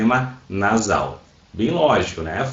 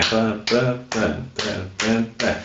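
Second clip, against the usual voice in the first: even, separate beats; counting syllable beats; 5 dB quieter, slower than usual. Shown as a demonstration. Teacher recalling clap-counting syllables at school, in rhythmic beats. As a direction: tap out each syllable like a clap. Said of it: "contar sílabas batendo palma".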